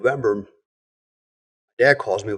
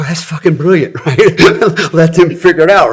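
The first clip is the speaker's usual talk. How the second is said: loud, laughing, high exclamation of delight; delighted laughing outburst; 7 semitones higher, 10 dB louder than usual. Retold or imitated. Son recalling his reaction to dad's answer, loud delighted laughter. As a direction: burst out laughing at a brilliant trick.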